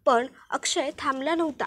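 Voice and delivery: ordinary delivery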